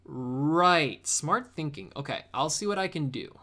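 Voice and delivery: usual speaking voice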